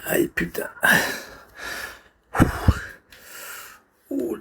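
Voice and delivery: breathy whispered wince; pained whisper. Real, not acted. Streamer whispering in pain. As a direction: streamer wincing at his foot cramp, in a whisper. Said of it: "j'ai une crampe".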